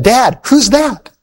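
put-on high, slow child's voice; lightly voiced little daughter asking her dad; little girl. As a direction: ask it like a small curious girl.

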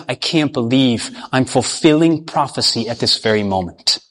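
a dawning horror under the breath; horrified realization. Retold or imitated. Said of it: "They would have been horrified".

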